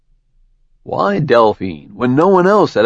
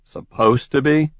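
"Supposed to be?" asked sharply, rising on "be"; alarmed question; 4 semitones higher than usual. Acted. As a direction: catch on her words, suspicious. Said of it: "set off Jericho's alarms".

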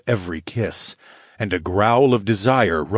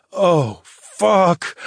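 higher, louder groaning curse; groaned curse; 10 semitones higher, 5 dB louder than usual. Acted. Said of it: "I groaned".

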